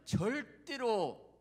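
절대로 drawn out, high and loud; stress on 절대로. Real, not acted. Preacher warns that nobody wins without Jesus, emphatic stress on 절대로. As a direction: punch 절대로 and stretch it slowly.

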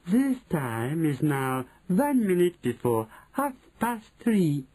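high, slow, drawn-out proclamation; stretched-out, high witch voice; Grand High Witch announcing. A narrator's reading. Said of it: "announced the Grand High Witch".

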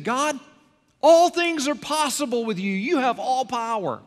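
high, loud, declaring God's power; fervent praise. Retold or imitated. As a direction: proclaim it upward.

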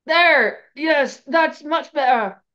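loud, high and drawn out; overdone relief. A narrator's reading. Student reacting to the healing spell, overdone loud relief. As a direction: announce your relief far too loudly.

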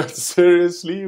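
a high, slow, incredulous "Seriously?"; disbelief. Real, not acted. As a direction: stretch it out in disbelief. Laughing disbelief at the rap.

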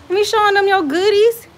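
high, loud call across the yard; high scolding call. Real, not acted. Mom calls out to kids undressing on camera, high loud scold.

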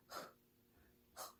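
흐 as a puff of air from an open throat; start of a sigh. Shown as a demonstration. Teacher showing the H sound, sighed 흐. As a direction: breathe out 흐 like beginning a sigh. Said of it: "한숨 쉬기 막 시작할 때".

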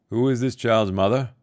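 calling out. A narrator's reading. Jurin calling to the fled disciples. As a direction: call out to anyone listening.

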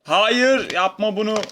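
loud, high cry of no; high loud protest; dismayed shout. Real, not acted. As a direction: yell in real dismay as it goes wrong. Character falls off ledge.

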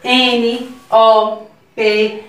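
each letter said slowly and clearly, with a pause after it; slow alphabet recitation. Shown as a demonstration. Teacher leading young kids through the alphabet, slow and clear. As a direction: lead the class letter by letter. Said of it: "Vamos fazer a leitura junto com tia Marina".

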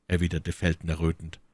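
plain reading voice

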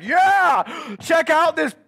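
put-on high, loud excited little boy; shouting kid voice, high and slow on the last words. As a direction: show off the rock like a thrilled six-year-old. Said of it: "and he's like".

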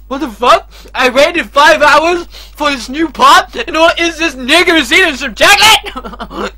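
mock indignant character. Voicing game character indignantly.

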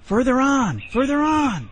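high, loud urging call; crying out. A narrator's reading. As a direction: call the child onward in a high, falling cry. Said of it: "cried Hooty".

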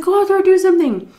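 high, loud, fast order; urgent plea. Retold or imitated. Urging her brother to break up the fight, loud and fast. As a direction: push him out the door with the voice. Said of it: "I said".

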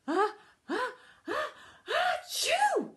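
high, building sneeze bursting loud; hammed-up storybook sneeze; acted sneeze. A narrator's reading. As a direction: build up to a huge achoo. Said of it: "sneezed Santa".

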